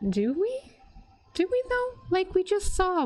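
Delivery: high incredulous question; skeptical. Real, not acted. Doubting the plan.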